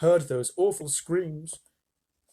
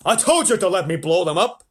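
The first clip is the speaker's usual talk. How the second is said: loud, raised military bluster; blustering army chief; 5 semitones higher, 8 dB louder than usual. A narrator's reading. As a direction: scold the president loudly. Army chief scolds president.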